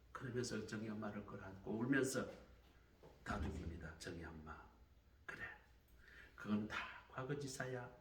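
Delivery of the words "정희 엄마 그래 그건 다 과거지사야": tender comfort. Acted. Comforting the weeping mother.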